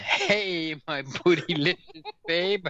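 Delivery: high, playful flirty read with a laugh. Acted. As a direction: tease it out like a come-on. Reading flirty message aloud.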